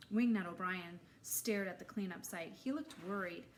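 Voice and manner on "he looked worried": ordinary delivery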